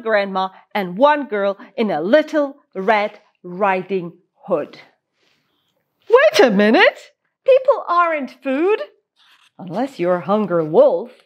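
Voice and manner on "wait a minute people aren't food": sudden high realization; surprised exclamation. Real, not acted.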